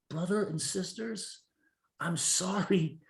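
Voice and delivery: mock-solemn apology. Meditation advocate answers weary friends, slow mock-solemn apology. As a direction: stretch it out gently, addressing a congregation.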